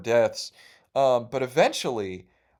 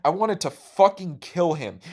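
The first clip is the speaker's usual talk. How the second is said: ordinary talk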